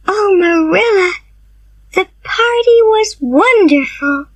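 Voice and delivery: very high, delighted gushing; happy girl. Acted. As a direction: gush to Marilla about the party. Anne home happy.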